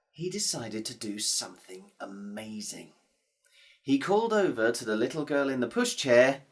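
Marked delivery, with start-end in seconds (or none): 0.1-2.9 secretive whisper, much softer; hushed storyteller whisper. A narrator's reading. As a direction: lean in and whisper the surprise.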